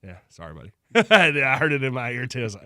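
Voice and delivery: ordinary delivery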